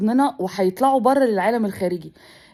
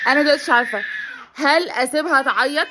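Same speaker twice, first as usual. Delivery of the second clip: tearful; 4 semitones higher than usual. Real, not acted. A worried mother on camera near tears. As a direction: voice catching as she holds back tears.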